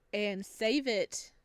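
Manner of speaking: ordinary talk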